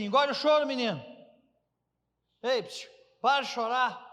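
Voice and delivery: put-on loud scolding of a crying boy; scolding parent. Preacher voicing a parent hushing a crying boy, loud scold. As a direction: bark at the child to stop crying.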